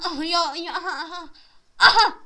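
loud laugh-shout; triumphant laughing. Real, not acted.

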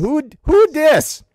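high, loud, drawn-out mocking question; mock incredulous. Mock-acts the phrase he dislikes.

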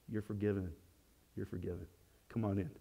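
a hushed, forgiving welcome; gentle God. Pretend. God gently welcoming a repentant sinner back, soft and tender.

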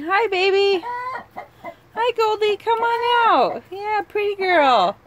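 high, slow, sweet coaxing; coaxing coo. Real, not acted. Owner coaxing her hen Goldie out in a slow high coo.

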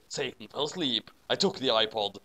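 ordinary delivery

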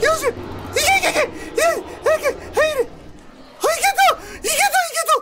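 high frantic urging and relief; real high-pitched frantic panic. Real, not acted. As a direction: squeak out the escape in panic. Frantic escape into safe room.

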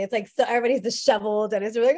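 ordinary talk

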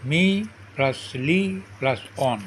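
"মি plus লি plus অন" split into three syllables; syllable breakdown. Shown as a demonstration. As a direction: pause after "মি", then space out "লি" and "অন". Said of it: "যে ওয়ার্ডে তিনটি syllable থাকে".

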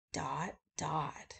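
soft breathy whisper trailing off; whispered dots. Acted. Narrator whispering the three dots at the list's end.

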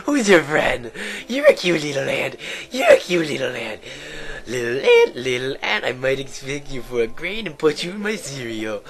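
hammed-up, delighted princess voice; Agatha. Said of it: "why am I still talking like that".

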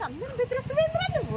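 high-pitched telling-off of a dog; scolding a pet. Real, not acted. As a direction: chide the dog in a high, drawn-up voice. Questioning a dog that bullies others. (కుక్కను హెచ్చు గొంతుతో మందలిస్తూ)

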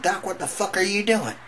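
high-pitched shocked demand; mock alarm at the doctor, voice shooting up; outraged protest. Outraged patient in the retold scene.

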